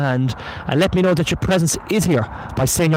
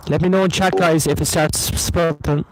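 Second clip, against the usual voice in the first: ordinary delivery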